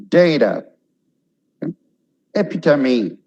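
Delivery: normal talking voice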